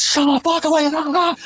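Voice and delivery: high, slow mock shout; retold yell. Narrator yelling back at the pair through the door, slow mock shout. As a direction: imitate yelling back at them. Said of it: "At full volume".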